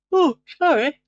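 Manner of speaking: yawning mid-word then apologising; through a yawn. Real, not acted. Late at night, yawning an apology to her viewers. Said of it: "I yawned then".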